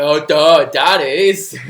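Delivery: loud, dim-witted talking dog; goofy, loud pet voice; dopey dog voice. Pretend. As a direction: blurt it out as a big dopey dog. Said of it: "he's still got the dopey voice".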